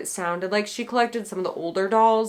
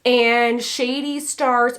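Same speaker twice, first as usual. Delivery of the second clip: ordinary talk, nothing marked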